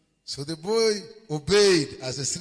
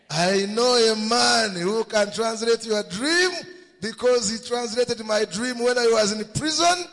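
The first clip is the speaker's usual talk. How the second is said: louder urgent telling; 6 semitones higher, 7 dB louder than usual. Acted. Servant repeats it, louder.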